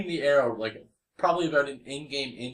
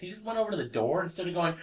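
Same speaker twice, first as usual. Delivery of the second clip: ordinary talk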